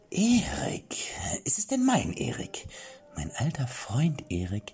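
cling to every word like a madman; mad old king. Acted. Mad king clings to his old friend, whispering high.

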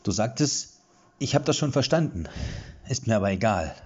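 quoting her. A narrator's reading. Erik quoting her first words.

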